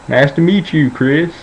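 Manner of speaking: high-pitched mock old Southern grandpa. Grandpa shaking hands with grandson's friend, high Southern voice. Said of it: "but this is how he talks".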